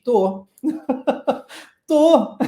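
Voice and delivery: ordinary delivery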